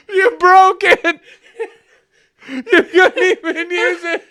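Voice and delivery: high, loud words through laughter; incredulous laughing. Real, not acted. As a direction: crack up while accusing. Laughing at broken toy.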